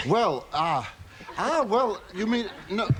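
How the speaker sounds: flustered, pitch leaping up; Frankie Howerd voice. Acted. Asked to say something, the guest dithers, Frankie Howerd style. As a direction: splutter and dither, going high and bewildered. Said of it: "You sound just like him".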